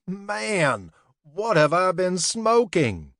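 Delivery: high, loud yell; laughing yell. A narrator's reading. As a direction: shout it out between fits of laughter. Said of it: "he yelled".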